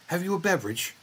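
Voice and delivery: prim English servant offering drink; British butler. Pretend. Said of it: "make fun of his Britishness".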